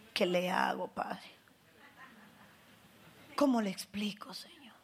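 hushed, pleading whisper; whispered prayer. Retold or imitated. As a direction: whisper the plea to the Father.

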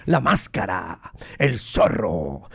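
very low, drawn-out mock-ominous voice; low, stretched theatrical growl; mock dramatic announcer. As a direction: announce the nickname deep and slow. Dramatic nickname for masked player.

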